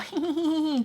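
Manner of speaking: high-pitched imitated giggle; mock giggle. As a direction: titter like a chummy mother, mockingly. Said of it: "kiki kiki with her".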